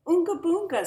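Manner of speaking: grandparents voice. A narrator's reading. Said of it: "said Grandma and Grandpa".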